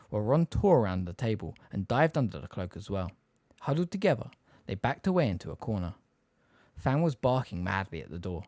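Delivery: usual speaking voice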